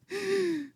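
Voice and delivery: high scornful cackle; mocking laugh. Mourners jeering at Jesus, high mocking laugh. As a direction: laugh at him like a mocking crowd.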